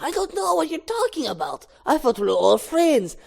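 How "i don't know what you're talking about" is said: cornered denial. Acted. Cornered suspect denies it.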